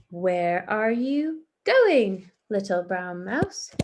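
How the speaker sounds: slow, loud, drawn-out sly fox. Acted. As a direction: voice the fox, stretching each word. Teacher voices Fox in story.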